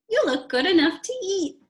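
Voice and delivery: high gushing mum; fond mother. Acted. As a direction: gush over the costume. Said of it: "Missus Lambchop said".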